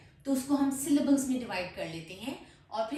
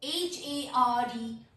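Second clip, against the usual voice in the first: slow letter-by-letter spelling; slower than usual. Shown as a demonstration. Teacher spelling a word for learners, slow spelling. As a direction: spell it out, a small gap after each letter. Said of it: "letter spell करती हूँ".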